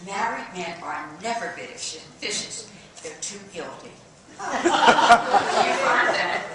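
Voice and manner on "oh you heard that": slow, loud, giggly campy delivery; laughing knowingly at her own joke; laughing aside. Acted. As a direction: drag the words out through a laugh. Campy aside about married men.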